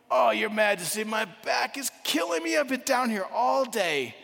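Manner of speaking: high, loud hammed-up grumble; loud complaining. Worn-out artist answering the king's question, loudly complaining. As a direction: moan loudly about your aches, pitched high.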